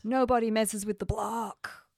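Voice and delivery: put-on American accent. Film podcaster quoting the block's motto in an accidental American accent. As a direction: say it like an American tough. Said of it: "put an American accent on then".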